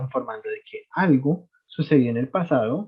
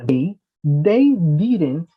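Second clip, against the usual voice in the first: slow demo; 3 semitones higher, 4 dB louder, much slower than usual. Shown as a demonstration. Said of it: "teacher demonstrates sentence slowly".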